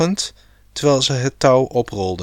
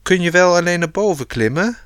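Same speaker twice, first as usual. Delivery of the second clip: higher, quick called question; calling out; 5 semitones higher, faster than usual. A narrator's reading. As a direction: call up lightly, as a narrator would. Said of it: "riep Eefje". (hoog en vlug geroepen vraag)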